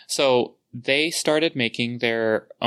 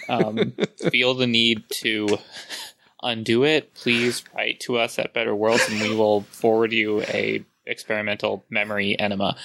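normal talking voice

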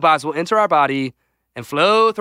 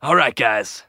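ordinary talk

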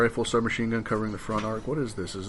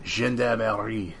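put-on slow, drawn-out Gendarmerie in a French accent; slower than usual. Reading a German unit name, in a mock French accent. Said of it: "You just did a German word in a French accent".

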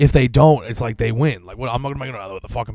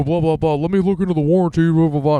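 higher and louder, sing-song mockery; mocking dealer voice; 6 semitones higher, 5 dB louder than usual. Mimicking the service lady's excuses, a higher mocking voice. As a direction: parrot their excuses dismissively.

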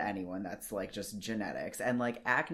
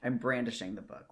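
ordinary delivery

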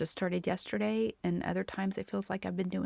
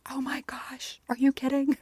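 excited whisper; 8 semitones higher than usual. Retold or imitated. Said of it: "I was like".

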